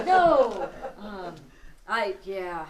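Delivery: burst of laughter. Real, not acted. Laughing after the ghost recording.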